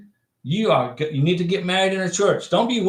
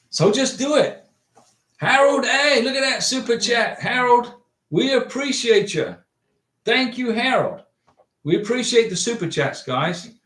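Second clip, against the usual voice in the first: higher, brighter thank-you to the chat; excited thanks; 6 semitones higher than usual. Real, not acted. Host thanking Harold for his super chat, excited and high. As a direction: lift up and cheer the donor on.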